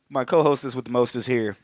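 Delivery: ordinary delivery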